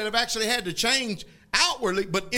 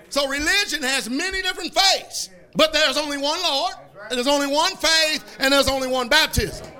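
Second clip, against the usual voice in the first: fervent preaching, building up; 4 semitones higher, 5 dB louder than usual. Acted. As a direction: preach it with full force. Preaching rises in force.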